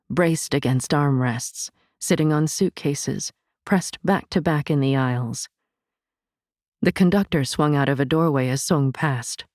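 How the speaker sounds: plain reading voice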